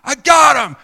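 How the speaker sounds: high, full-force holler upstairs; yelling to Danielle. Retold or imitated. Husband calls up to Danielle that he caught the boy, full-force yell. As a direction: yell it up to the house at the top of your voice. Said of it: "yell up to Danielle".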